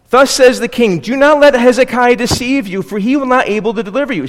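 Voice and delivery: high, loud herald's cry; loud proclamation. Acted. Assyrian spokesman calling to the people on the wall, loud proclamation. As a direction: call it out to the whole wall. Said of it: "cried out in the loud voice".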